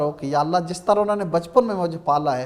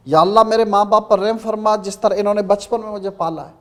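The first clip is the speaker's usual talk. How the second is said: ordinary delivery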